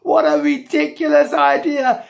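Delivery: high, loud scornful exclamation; mocking hilarity. A narrator's reading. Policeman ridiculing Jack's idea, loudly derisive. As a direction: crow it out through acted hilarity. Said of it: "he laughed so much he started shaking".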